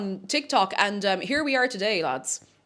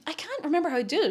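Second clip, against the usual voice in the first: ordinary talk, nothing marked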